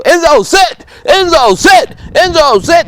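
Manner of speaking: high, loud repeated yelling of the command; screaming at the dog. Shown as a demonstration. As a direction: bark the command over and over, frantic. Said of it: "if I'm just sitting out here screaming at Enzo".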